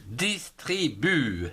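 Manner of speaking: "Distribue" in three slow, separate beats; syllable by syllable. Shown as a demonstration. Teacher shows pupils the syllables, "Distribue" slowly split in three. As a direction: break "Distribue" into dis, tri, bue. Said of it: "trois syllabes".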